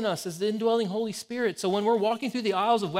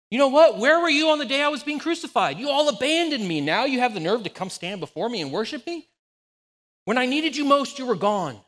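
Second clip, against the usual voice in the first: much higher, louder, bitter reproach; ticked off; 6 semitones higher, 5 dB louder than usual. Pretend. Imagined Jesus scolding disciples who abandoned him, angry. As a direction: let the hurt of abandonment bite. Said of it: "Jesus could have just been ticked off".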